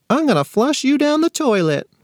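put-on high, childish little-boy voice; toddler threat voice. A narrator's reading. Little brother threatening his sister, in a high toddler voice. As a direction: squeak it out like a tiny tot threatening.

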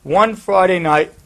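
ordinary talk

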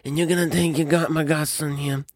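a hint of a Godfather-style Italian American accent, pitching a deal; Cosimo de' Medici Italian American accent. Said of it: "Cosimo de' Medici always having that type of American Italian accent".

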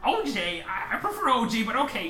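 rally the troop onward; scoutmaster voice. Acted. Scoutmaster to his scout, a high hearty rally.

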